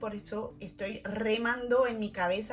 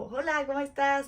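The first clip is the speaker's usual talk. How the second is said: louder, higher, performed hello; friendly greeting; 4 semitones higher, 5 dB louder than usual. Retold or imitated. Hailing someone she doesn't know, extra friendly. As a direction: greet with put-on familiarity.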